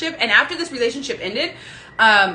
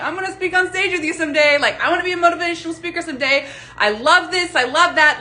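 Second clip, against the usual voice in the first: higher, fast gushing fan messages; 6 semitones higher, 3 dB louder than usual. Retold or imitated. Fan gushes excitedly in messages to her favourite speaker. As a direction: gush like an eager fan.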